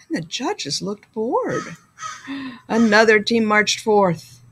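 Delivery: usual speaking voice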